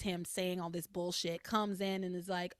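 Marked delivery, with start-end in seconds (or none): none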